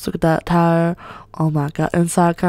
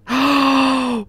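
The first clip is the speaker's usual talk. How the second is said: long high-pitched gasp; thrilled gasp; 6 semitones higher than usual. Real, not acted. Gamer finding she can go inside, gasping. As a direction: gasp with excitement.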